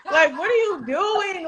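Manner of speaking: real laughter in the voice; laughing. Real, not acted. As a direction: giggle while asking. Still laughing at brother.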